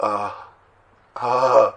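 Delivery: wordless sighing "ah"; demonstrated groan. Shown as a demonstration. Teacher showing a groan not even addressed to God, wordless moan. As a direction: let out an aimless groan, as a demonstration. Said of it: "נאקה זה אפילו לא לקדוש ברוך הוא".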